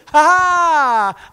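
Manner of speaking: loud, high drawn-out gloating "ha"; mock devil cackle. Devils waiting for the runaway, loud gloating laugh. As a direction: crow like a devil springing his trap.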